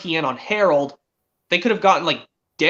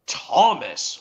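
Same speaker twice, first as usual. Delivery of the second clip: cross boss voice, a little lower; Sir Tom Hat; 4 semitones lower than usual. Acted. Quoting the railway boss still cross at Thomas, mock cross. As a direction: scold as a stern railway boss. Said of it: "Sir Tom Hat was still cross at Thomas".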